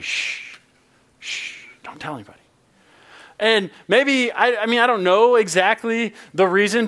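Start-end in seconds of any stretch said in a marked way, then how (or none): 0.0-1.6 soft hushing shh; shushing. Acted. Jesus shushing the healed men, soft. 1.8-2.4 hushed. Acted. Jesus hushing healed men.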